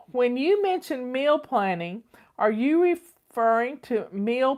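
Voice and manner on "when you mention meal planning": ordinary delivery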